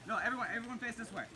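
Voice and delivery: ordinary delivery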